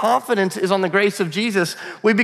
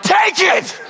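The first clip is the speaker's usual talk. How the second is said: gloating shout; 13 semitones higher than usual. Pretend. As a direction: shout it in his face like a winner. Imagined gloating at the dude.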